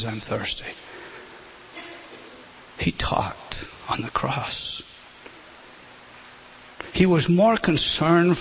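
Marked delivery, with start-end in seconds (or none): 0.0-0.7 hushed. Acted. Last saying of Christ, quoted. 2.8-4.8 a slow hushed whisper, as a solemn point. Real, not acted. Solemn point after the sayings.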